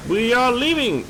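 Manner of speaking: high, swooping singsong call; playful announcement. Real, not acted. Announcing departure in game.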